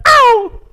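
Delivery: high mocking ouch of a pinched churchgoer; mock yelp. Preacher mocks Christians who yelp when pinched, with a high squeal. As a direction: squeal it like a whiny believer. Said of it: "teriaknya kalau dicubit aja teriak".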